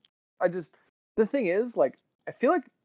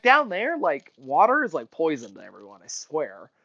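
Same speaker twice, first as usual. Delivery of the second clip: ordinary talk, nothing marked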